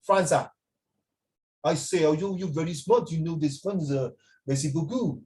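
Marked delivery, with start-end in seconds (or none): 2.0-5.2 put-on a light, teasing French accent with a sprinkling of French words; gently hammed-up French accent, slowing down at the end; mock French accent. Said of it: "That's how they talk".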